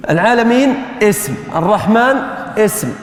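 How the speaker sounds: normal talking voice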